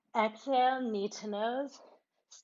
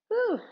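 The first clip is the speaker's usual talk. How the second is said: a high, loud whoop of joy; excited woo; 13 semitones higher, 7 dB louder than usual. Real, not acted. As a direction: let out a bright woo as you rise. Getting tall, then laughs.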